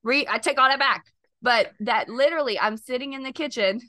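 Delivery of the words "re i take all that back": ordinary talk, nothing marked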